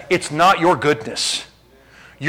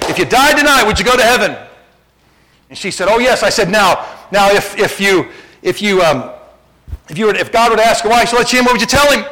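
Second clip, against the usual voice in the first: higher, louder, pressing question; fervent witnessing question; 4 semitones higher, 4 dB louder than usual. Retold or imitated. Preacher retelling asking a lady about heaven, lightly fervent. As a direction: press her for an answer.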